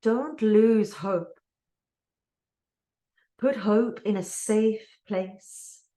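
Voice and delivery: deliberate delivery, weighting the key words; poem reading. Real, not acted. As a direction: read it as a poem, line by line, with pauses. Reads a poem aloud.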